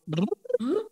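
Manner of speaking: lip trill with squished cheeks. Shown as a demonstration. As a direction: squeeze the cheeks and buzz the lips. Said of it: "squeeze your cheeks together like this".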